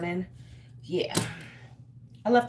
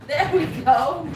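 laughing; 5 semitones higher, 6 dB louder than usual. Real, not acted. Pleased after fixing the lights.

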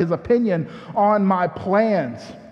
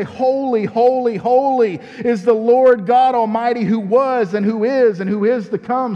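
higher, louder worship cry; fervent proclamation; 4 semitones higher, 4 dB louder than usual. Acted. Pastor leading praise, fervent proclamation. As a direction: proclaim like an anthem.

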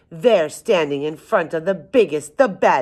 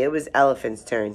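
plain reading voice

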